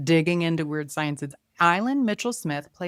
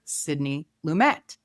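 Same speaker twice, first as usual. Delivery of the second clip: ordinary talk, nothing marked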